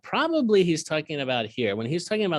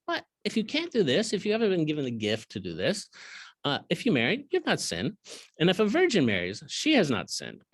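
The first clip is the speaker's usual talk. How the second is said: lifted, higher reading voice; scripture reading; 4 semitones higher than usual. Acted. Teacher reading the verse to his students, raised pitch. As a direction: proclaim the verse.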